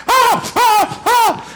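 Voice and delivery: high, loud exasperated "ah, ah"; exasperated cry. Retold or imitated. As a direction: cry out at the pileup like a frazzled coach. Frantic kids crowding the ball.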